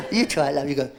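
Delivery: ordinary delivery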